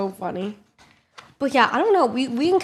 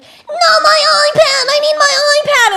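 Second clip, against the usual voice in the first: put-on tantruming kid; 13 dB louder than usual. Son told the iPad is being taken away, wailing. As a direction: bawl it like a distraught child.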